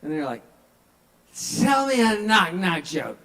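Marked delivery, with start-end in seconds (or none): 1.6-3.2 put-on loud, higher pushy drunk demand. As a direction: insist like a tipsy passenger. Drunk passenger demands joke.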